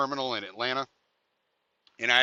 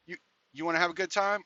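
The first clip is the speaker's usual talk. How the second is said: higher, fast inviting question; young woman's come-on; 5 semitones higher, faster than usual. Retold or imitated. Young woman at the truck offers, fast and higher. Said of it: "she goes".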